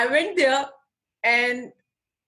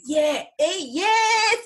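thrilled yes; 9 semitones higher, much slower than usual. Retold or imitated. Accepting the booking, bursting with joy. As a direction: squeal a delighted yes. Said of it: "And I was like".

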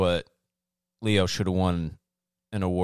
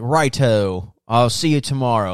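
put-on cheery British accent, a bit louder; 3 semitones higher, 6 dB louder than usual. Dad answering the phone, imitating a British accent. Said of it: "talks with a British accent when he answers the phone".

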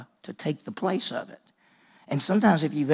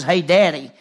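put-on loud child's call; 9 dB louder than usual. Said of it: "But this is".

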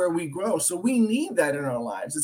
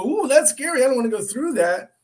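higher, louder worried outburst; mock alarm; 8 semitones higher, 5 dB louder than usual. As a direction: recoil from it with a pitched-up "oh". Imagines seeing a scary future.

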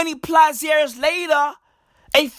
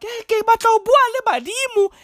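eager ancestor; 9 semitones higher, much faster than usual. Retold or imitated. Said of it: "our people were like".

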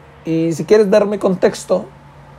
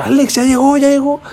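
put-on high, louder kid shouting the news; excited shout; 9 semitones higher, 4 dB louder than usual. Classmate shouting to Alex that Paola arrived, high excited. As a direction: yell it like a kid spotting her. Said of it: "me grita".

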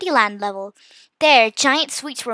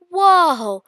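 higher, louder amazed exclamation; 5 semitones higher, 6 dB louder than usual. A narrator's reading. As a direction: let out a whoa of wonder. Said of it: "I replied in amazement".

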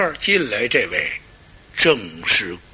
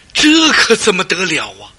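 ordinary delivery, nothing marked

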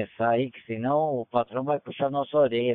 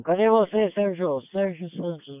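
high, raised call across the channel; 7 semitones higher than usual. Real, not acted. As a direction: call his name out high. Calling out for Sérgio on radio.

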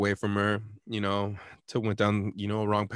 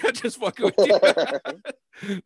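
high, louder, through laughter; laughing; 8 semitones higher, 6 dB louder, much slower than usual. Retold or imitated. Prankster admits the joke to Tim, laughing. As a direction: crack up while retelling the line. Retold apology, breaking into laughter.

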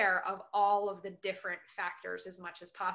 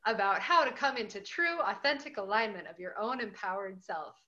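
higher, louder TV ad announcer; drug commercial voice; 3 semitones higher, 5 dB louder than usual. Acted. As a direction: sell it like a pharma ad. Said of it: "This is sounding like a, a drug commercial".

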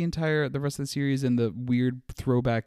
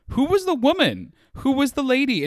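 incredulous; 7 dB louder than usual. Real, not acted. Baffled by unexplained plot point.

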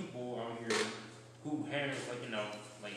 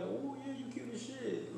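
put-on quoting a girl, pitched way up; a much higher, sweet quoted voice; high girly voice; 12 semitones higher, 4 dB quieter than usual. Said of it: "they be like".

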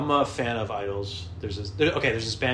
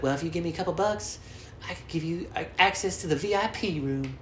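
higher put-on voice, same level; venue worker impression; 8 semitones higher than usual. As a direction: pitch it up, offering a sly deal. Acts venue worker offering deal.

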